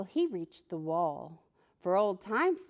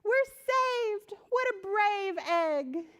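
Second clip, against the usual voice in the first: very high, thrilled cry; king's men saved, high joyful cry; relieved cheer; 12 semitones higher, slower than usual. Acted. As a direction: shout with relief. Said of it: "the king's men are saying".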